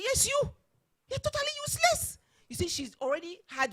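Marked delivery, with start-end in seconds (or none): 0.0-2.2 very high-pitched, loud mock accusation; retold shouting. Chairman singling out the woman before everyone, shouting. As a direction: imitate a boss yelling in outrage. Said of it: "the man shouting at her".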